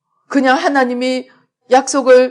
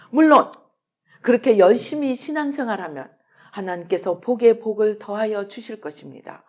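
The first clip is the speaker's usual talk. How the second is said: usual speaking voice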